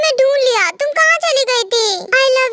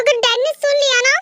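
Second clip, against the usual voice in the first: usual speaking voice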